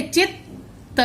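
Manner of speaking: चित्त with a held stop on त्त; held half t. Shown as a demonstration. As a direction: show the doubled त in चित्त. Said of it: "तो आधा त कैसे बोलेंगे?".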